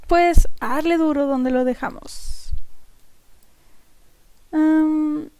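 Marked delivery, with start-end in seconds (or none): none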